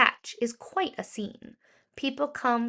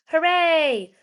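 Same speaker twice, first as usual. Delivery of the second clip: loud, high cheer of victory; cheering shout; 7 semitones higher, 9 dB louder than usual. Acted. Pumpkins cheering Spookly for saving the day, loud high shout. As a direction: shout it out like a happy crowd. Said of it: "All the pumpkins shouted".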